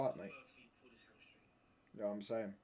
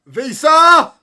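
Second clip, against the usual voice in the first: yelled name, much louder and higher; shout; 28 dB louder than usual. Real, not acted. Streamer yelling the scorer's name. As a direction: bellow it out.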